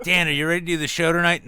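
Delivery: loud, high-pitched eager question; loud mock call-out. Host checking before tonight's show, loud mock call-out. Acting out a pre-show exchange.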